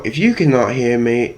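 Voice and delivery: ordinary talk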